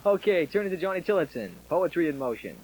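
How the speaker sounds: punchy radio song intro; upbeat military radio host. Real, not acted. DJ introducing record.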